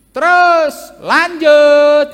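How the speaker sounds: loud, slow, drawn-out call to carry on; booming like a careless council member; mock sanguine official. Sanguine council member waving the hearing on, loud and slow. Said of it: "katanya begitu kan".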